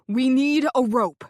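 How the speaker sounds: loud single bark of a word; yelled. Acted. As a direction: shout the need. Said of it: "the man next to me yelled".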